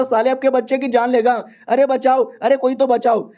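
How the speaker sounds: a frantic plea to anyone near; calling for help. Acted. Father calls for help.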